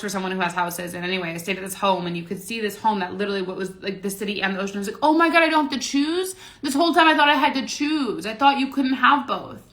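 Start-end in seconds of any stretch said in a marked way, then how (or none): 5.0-9.6 much higher and louder, thrilled disbelief; gasping, overjoyed epiphany; amazed realization. Retold or imitated. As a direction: burst out with the discovery. Retelling her sudden realization.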